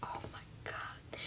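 breathless whispered oh my God; hushed, amazed whisper; awed whisper. Real, not acted. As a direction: whisper it in awe at the colours. Tape removed, sees result.

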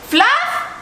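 high worried call; anxious high call across the room. Real, not acted. Searching, calling for Fluff.

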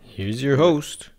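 slow build to a loud, high, stretched name; big talk-show introduction, swelling on the name; show announcer. Acted. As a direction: announce the host, holding the name very long.

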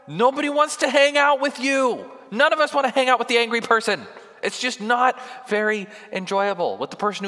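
preacher rising to full force on anger; preaching at full force. Acted. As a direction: hammer the point home to the congregation.

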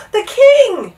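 high, loud gasp of sudden alarm. A narrator's reading. Bartholomew suddenly remembers the king in the hubbub, gasped. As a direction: blurt it out in shocked realization. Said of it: "Bartholomew gasped".

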